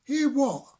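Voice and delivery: high, loud surprised frog; a startled frog asking back. Acted.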